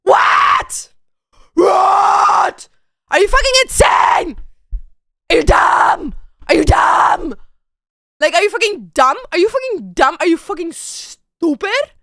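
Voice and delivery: loud shouted what and insults; furious disbelief. Real, not acted. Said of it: "incredulous angry outburst".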